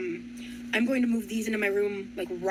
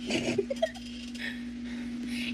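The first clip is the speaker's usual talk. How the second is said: silent wheezing laugh; breathy laugh; 4 dB quieter than usual. Real, not acted. To viewers after the mishap: airless wheezing laugh. After failing to fit item.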